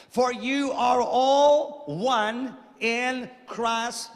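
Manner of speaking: drawn-out preaching. Acted. Preacher drawing out the scripture.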